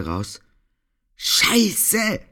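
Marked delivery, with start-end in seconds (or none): 1.2-2.2 high, metallic curse over the phone; yelped curse through a tiny speaker; tinny shout through earphone. Acted. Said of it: "tönte es metallen aus dem kleinen Kopfhörer".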